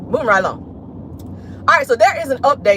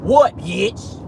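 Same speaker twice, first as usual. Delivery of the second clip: tough challenge; much slower than usual. Retold or imitated. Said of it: "You going in there like".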